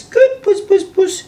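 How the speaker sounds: very high falsetto coo; praising a cat. Retold or imitated. Girlfriend praises the rubbing cat, high cooing.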